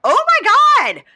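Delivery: very loud, high, drawn-out shriek; imitated yelling. Cast members watching him flirt, imitated appalled yelling. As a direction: shriek it slowly like an appalled crowd. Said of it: "All the cast members are yelling".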